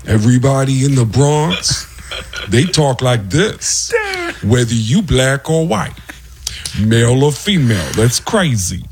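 slow, drawn-out Bronx comic impression; deliberate, put-on Bronx talk; Tracy Morgan. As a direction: imitate a drawling Bronx voice, spacing out each phrase. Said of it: "sounds like Tracy Morgan".

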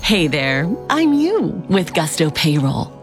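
ordinary delivery

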